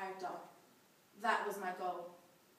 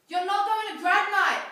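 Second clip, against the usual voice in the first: much louder and higher, drawn-out angry shout; furious yell; 10 semitones higher, 18 dB louder, slower than usual. A narrator's reading. Furious mum punishing her daughter for drunken trouble, yelled. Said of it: "she yelled".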